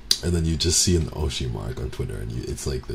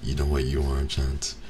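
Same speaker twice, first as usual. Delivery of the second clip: ordinary talk, nothing marked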